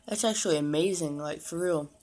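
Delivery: biting snack mid-sentence; chewing between words; talking while eating. Real, not acted. Seen 1: holds snack, bites it at 1.0s while talking, chewing after.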